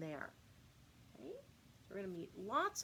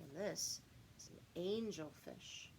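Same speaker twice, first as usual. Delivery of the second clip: usual speaking voice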